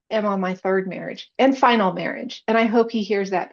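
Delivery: ordinary talk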